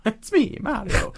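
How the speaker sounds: high-pitched cartoon Italian accent; Mario. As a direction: attempt a squeaky Italian accent through giggles. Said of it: "try and go Italian".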